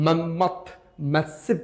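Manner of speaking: normal talking voice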